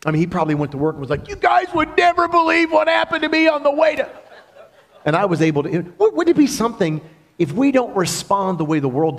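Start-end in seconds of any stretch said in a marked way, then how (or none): 1.3-4.1 high, laughing, eager voice; a man bursting to tell his amazing story; excited retelling. Retold or imitated. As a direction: gush about it to coworkers while laughing. Imagined driver telling coworkers.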